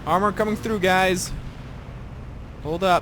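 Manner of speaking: loud excited battle callout; coming through shout. Real, not acted. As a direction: yell it out to teammates. Shouting callouts during tank push.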